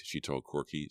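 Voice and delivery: ordinary delivery, nothing marked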